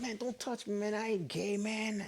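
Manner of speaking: high, hushed retold protest; indignant protest. To the guy next to him in church, indignant protest. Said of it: "That's how I said it then".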